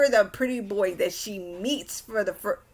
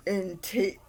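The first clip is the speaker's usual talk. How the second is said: normal talking voice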